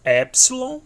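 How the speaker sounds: Epsilon said high and loud, stressed on the first syllable as /ɛpslo/; pronunciation demo. Shown as a demonstration. Teacher drilling the Greek letter Epsilon, high and loud. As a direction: call out Epsilon high and loud, rising at the end.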